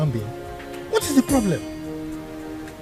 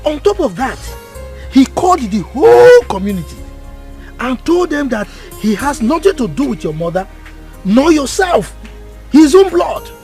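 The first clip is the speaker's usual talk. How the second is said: louder, building furious tirade; rising anger, louder and louder; angry rant; 3 semitones higher, 7 dB louder than usual. Acted. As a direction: hammer home the king's betrayal. Uncle ranting about the king.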